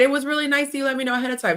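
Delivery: quick, bright, high thank-you; grateful quote. Retold or imitated. Telling her partner she appreciated the warning, quick and high. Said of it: "I said".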